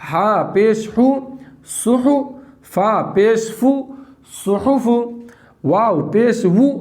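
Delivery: slow syllables with a lifted joined word; slow spelling drill. Shown as a demonstration. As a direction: model the reading clearly. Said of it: "teacher drills syllables".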